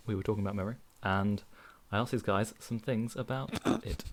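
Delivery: slow, measured host read over music; radio-style segment opener; podcast intro read. Acted. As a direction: welcome the listeners back at an unhurried pace. Host reads part two intro.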